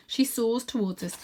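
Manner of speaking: ordinary delivery, nothing marked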